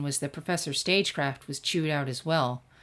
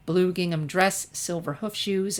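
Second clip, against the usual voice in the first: ordinary delivery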